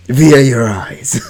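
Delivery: slow, loud laughing words. Real, not acted. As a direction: laugh while you read. Laughs through the narration.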